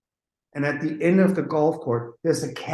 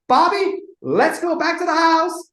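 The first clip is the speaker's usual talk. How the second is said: very high, raised voice; imitated calling out; 4 dB louder than usual. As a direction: summon a husband, hammed-up. [name] calls to [name].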